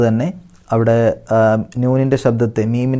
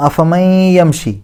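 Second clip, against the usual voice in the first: higher, slowish chant; Quran recitation; 6 semitones higher, 3 dB louder, much slower than usual. Shown as a demonstration. Teacher recites the next verse. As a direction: chant it with a merging hum. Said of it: "ബിഗുന്ന, മണിക്കുക".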